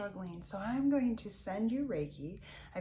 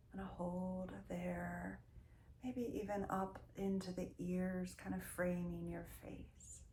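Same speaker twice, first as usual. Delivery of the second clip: guided meditation; 7 dB quieter than usual. Real, not acted. Leads a Reiki body-scan meditation.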